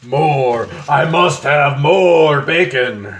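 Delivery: slow, drawn-out hammed-up monster demand; greedy bacon monster. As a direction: growl it like a bacon-starved beast. Greedy cry for more bacon.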